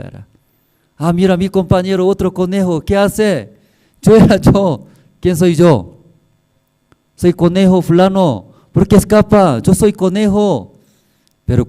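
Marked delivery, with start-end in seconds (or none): 1.0-10.7 voz de conejo mucho más aguda y algo más fuerte; chillón de sorpresa, como conejito que grita desde dentro; conejo animado. Acted. Conejo dentro del león habla. (el conejo dentro del león llama a su compañero, voz aguda y exaltada)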